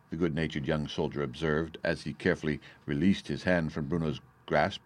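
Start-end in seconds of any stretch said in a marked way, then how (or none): none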